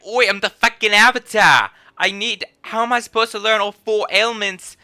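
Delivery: high, loud mock Scottish accent; hammed-up Scottish accent slipping towards Australian; attempted Scottish accent. Scottish meme Avatar complaining about learning elements, badly faked Scottish accent. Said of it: "but Scottish".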